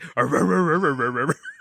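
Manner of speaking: rapid rolling slobbery dog noise; repeated "ruh-ruh-ruh" dog imitation; mock dog licking noise. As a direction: imitate a dog lapping at a face. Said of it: "like, licks his face?".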